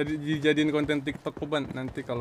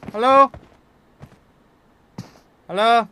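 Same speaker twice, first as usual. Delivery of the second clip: loud, rising call; 9 semitones higher, 9 dB louder than usual. Real, not acted. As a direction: shout to get attention. Calling out to teammates.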